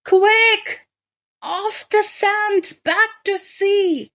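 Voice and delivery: high, loud, urgent little snail voice; a tiny creature shrieking for help, high and sharp; snail crying out. A narrator's reading. Snail cries to the beached whale, high and frantic. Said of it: "cried the snail".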